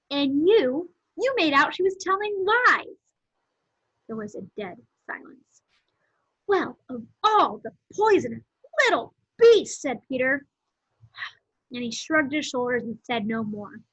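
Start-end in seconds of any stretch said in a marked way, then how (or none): 0.1-3.0 higher, louder indignant reproach; indignant accusation. A narrator's reading. Peter to Edmund about Lucy's supposed lies, indignant. 6.5-9.8 loud, swooping indignation; outraged scolding. A narrator's reading. Peter to the brother who lied, outraged.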